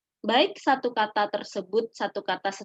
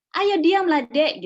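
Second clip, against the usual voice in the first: put-on shouted hush at a fussing baby, higher and louder; marah menyuruh diam; 7 semitones higher, 7 dB louder, slower than usual. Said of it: "terus orang tuanya". (orang tua membentak bayi dengan nada marah)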